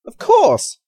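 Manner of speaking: high, loud burst of realisation; startling eureka shout; sudden exclamation. A narrator's reading. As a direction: cry out as it dawns. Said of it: "at Mark's exclamation".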